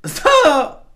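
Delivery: high loud shriek. Real, not acted. Overwhelmed by laughter.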